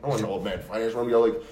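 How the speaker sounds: usual speaking voice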